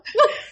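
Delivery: short high squealing laugh; high burst of laughter. Real, not acted. Teasing her friend about the mob man, a high laugh.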